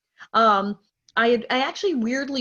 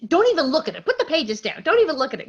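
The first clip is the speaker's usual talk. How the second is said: urgent fatherly commands, higher and louder; insistent retold orders; 6 semitones higher, 4 dB louder, faster than usual. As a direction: give urgent orders, imitating an excited dad.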